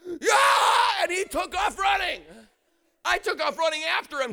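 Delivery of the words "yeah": high, loud mock scream; hammed-up shriek of panic; imitated terrified yell. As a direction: scream like someone bolting in fright. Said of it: "He went".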